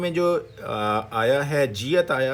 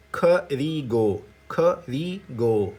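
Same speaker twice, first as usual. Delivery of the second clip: slow, drawn-out teaching of each syllable; syllable-by-syllable demo; much slower than usual. Shown as a demonstration. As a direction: sound it out: کھ, ری, گو. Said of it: "تو کیا بن جائے گا". (ایک ایک جز الگ کر کے آہستہ بولیں)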